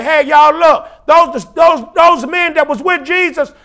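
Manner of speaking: crowd calling out, building to a high shout; astonished crowd. Acted. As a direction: exclaim it loudly to everyone around you. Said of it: "Everybody say".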